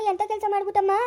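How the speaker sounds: ordinary talk, nothing marked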